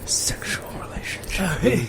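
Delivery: slow whispered soundboard drop; sound effect voice. Pretend. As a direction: replay it like a button clip. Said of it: "He keeps pressing the button".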